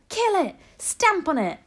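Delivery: loud, high hunting cries; shouting. A narrator's reading. Cooks urging each other to kill a mouse, shouting. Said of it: "they were shouting".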